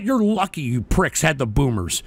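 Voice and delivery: ordinary talk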